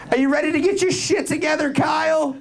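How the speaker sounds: a mock mother yelling at her son; hammed-up angry mom voice; stern mom. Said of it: "What does your mom say".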